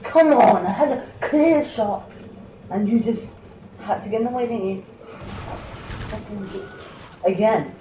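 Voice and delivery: high, loud frustrated yelling at a teammate; angry outburst. Real, not acted. Gamer yelling at a teammate who blocked his shot, angry.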